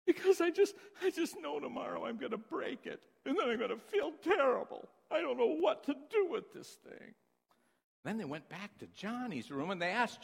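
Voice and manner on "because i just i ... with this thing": put-on higher, worried little boy voice; whiny worried kid. Crying twin tells parents his fear, lightly whiny child voice. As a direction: fret like a gloomy child. Jimmy sobbing over gift.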